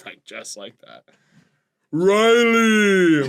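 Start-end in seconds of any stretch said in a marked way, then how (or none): 1.9-3.3 drawn-out ghostly call; ghost Santa voice. Acted. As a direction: call the name like a haunting spirit. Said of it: "Santa always kinda sound like a ghost".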